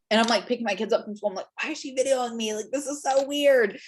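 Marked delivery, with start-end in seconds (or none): 1.6-3.8 mild mock bewilderment, higher pitch; puzzled reaction. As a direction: retell being baffled by an odd call. Retelling her puzzled reaction to call.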